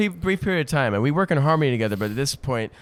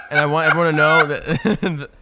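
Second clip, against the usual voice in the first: ordinary talk, nothing marked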